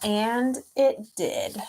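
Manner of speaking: storybook reading; story narration. A narrator's reading. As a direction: read it like a picture book to a class. Reads picture book aloud.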